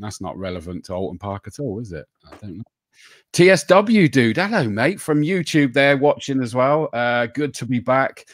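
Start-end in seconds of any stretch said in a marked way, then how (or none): none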